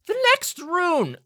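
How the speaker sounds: high, slow, loud announcement; grand professor. Acted. Professor back to the lesson, high, loud and slow. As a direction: announce the rune theatrically.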